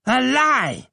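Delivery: high, loud shriek; hateful old man shrieking at the court; scream. A narrator's reading. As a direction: scream the denial. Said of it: "screamed Abdullah".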